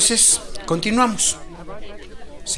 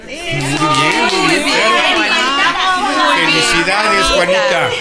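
cheering praise; 4 semitones higher, 6 dB louder than usual. Acted. Doctor praises Juanita's correct answer.

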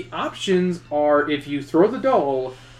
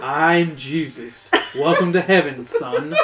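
joking Jesus; slower than usual. Acted. Mock joke voice, laughing.

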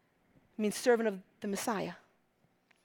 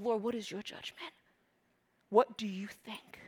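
soft whispered prayer to the Lord; hushed prayer; 2 semitones lower, 8 dB quieter than usual. Shown as a demonstration. Preacher voicing a quiet prayer for the congregation. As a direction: murmur it like a private prayer. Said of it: "Bring it before the Lord".

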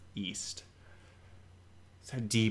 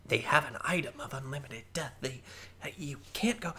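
urgent whisper; 5 semitones higher, 6 dB quieter, faster than usual. Acted. Elder hisses at goblin.

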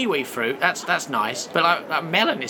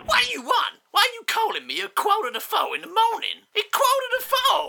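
put-on very high, cracked old voice, complaining with a laugh; a woken ancestor squawking down the phone; cranky ancient man. Great Grandpappy Doo, woken at four, high indignant squawk.

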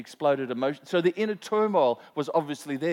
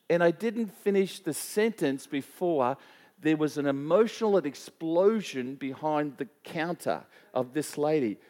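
ordinary talk

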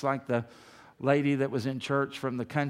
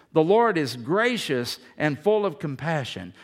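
scripture reading; 4 dB louder than usual. Real, not acted.

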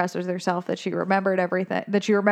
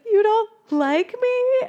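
much higher, slow and wounded; Dory's hurt little question, pitched way up; 13 semitones higher, slower than usual. Retold or imitated. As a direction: ask it like a fish about to cry. Dory hurt, then sobs.

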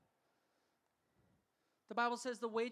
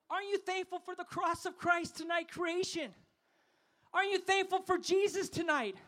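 rally the crowd in a raised, higher voice; rousing preaching; 5 semitones higher than usual. Acted. Preacher asking the crowd for thanks, rousing preaching.

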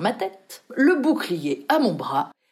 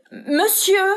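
second rising call; 10 semitones higher than usual. A narrator's reading. Joseph waking his master, second call, higher still. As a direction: climb one more step on "monsieur". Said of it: "avec une progression chromatique de la voix".

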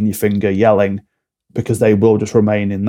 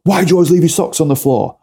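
higher, faster accusing complaint; nagging partner; 6 semitones higher, faster than usual. Retold or imitated. Partner complaining about socks on the floor, higher and nagging. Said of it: "But couples do this now".

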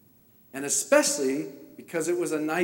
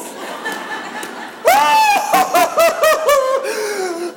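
long, very high laughing howl; loud laughing wail; 12 dB louder than usual. Retold or imitated. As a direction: burst into a huge hammed-up laugh. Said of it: "I'm laughing".

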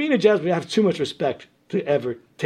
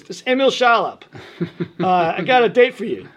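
raised, loud Brooklyn caller voice; Emil Shalop; 4 semitones higher, 4 dB louder than usual. Retold or imitated. Brooklyn contractor phoning to offer a date, loudly. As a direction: announce it like a straight-up Brooklyn guy. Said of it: "a funny Brooklyn sounding voice".

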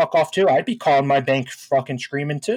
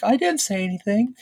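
high, innocent denial; meek backing down; 6 semitones higher than usual. Retold or imitated. Mom avoiding a face-to-face confrontation, meek denial. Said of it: "not a very confrontational person".

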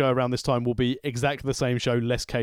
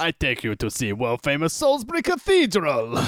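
fake Russian accent; 4 semitones higher, 3 dB louder than usual. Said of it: "trying to do a Russian accent".

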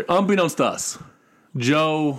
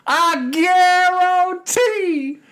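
mocking quote; much slower than usual. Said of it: "and I quote".

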